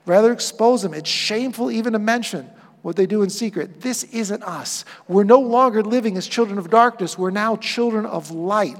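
ordinary talk

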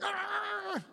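sudden high yelp of pain; mock pained yelp. Balaam crying out as his foot is crushed, high yelp.

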